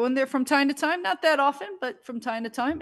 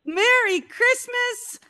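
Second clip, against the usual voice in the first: excited; 11 semitones higher, 6 dB louder, much slower than usual. Real, not acted. Greeting caller, drawn out high.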